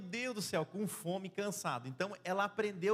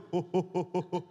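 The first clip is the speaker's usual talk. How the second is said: gargalhada curta e alta; riso alto; 6 dB louder than usual. Real, not acted. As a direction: solte uma risada. Riso da brincadeira. (riso alto)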